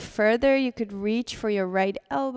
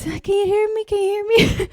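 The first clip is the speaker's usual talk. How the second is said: higher, calling into a bad connection; Zoom call voice; 10 semitones higher, 6 dB louder, faster than usual. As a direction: mimic someone checking their mic on a call. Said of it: "like in Zoom meetings or, uh, Teams".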